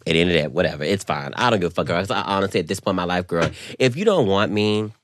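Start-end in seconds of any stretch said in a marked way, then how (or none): none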